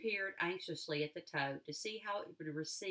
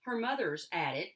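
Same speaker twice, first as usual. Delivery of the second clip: plain reading voice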